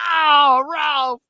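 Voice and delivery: high, drawn-out group cry; crowd's delighted reaction. Retold or imitated. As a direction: imitate everybody crying out his name. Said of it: "Everybody's like".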